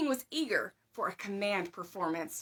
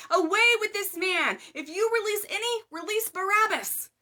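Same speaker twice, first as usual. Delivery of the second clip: much higher, much louder roaring crowd cry; mob shout; 10 semitones higher, 8 dB louder than usual. A narrator's reading. The multitude shouting at Pilate, a furious roar.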